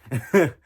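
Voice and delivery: a short, loud burst of laughter. Real, not acted. Vlogger reacting to the clip with a loud laugh.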